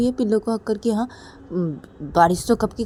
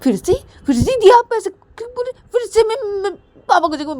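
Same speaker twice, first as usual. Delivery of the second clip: high, loud outraged little sister; shrieking child; 10 semitones higher, 5 dB louder than usual. Acted. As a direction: threaten like a furious kid. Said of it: "Uma बोलती कि".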